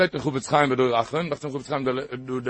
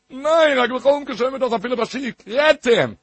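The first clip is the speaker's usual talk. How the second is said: high, raised preaching voice; insistent exhortation; 7 semitones higher, 4 dB louder than usual. Real, not acted. Preacher correcting his listeners' excuse, insistent exhortation. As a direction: push each phrase out high and emphatic.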